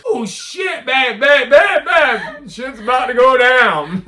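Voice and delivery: high, loud panicked calls; alarmed laughing shout. Retold or imitated. Speaker warning his partner as trouble starts. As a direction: yell to your partner in glee and alarm. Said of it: "Christina, ah, nasty back".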